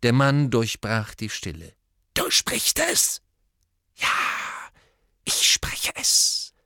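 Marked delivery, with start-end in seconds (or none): 2.1-3.2 high, slow snake hiss; hissing Parseltongue. Acted. Morphin speaks Parseltongue. 4.0-6.5 slow whispered snake hiss; hissing Parseltongue. Acted. Riddle answers in Parseltongue.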